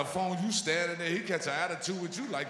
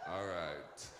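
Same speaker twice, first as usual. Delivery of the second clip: low, slow, unhurried Jamaican clerk voice; lazy Jamaican clerk; 5 semitones lower than usual. Retold or imitated. Jamaican clerk stalling the customer, low and slow. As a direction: take your time like the clerk.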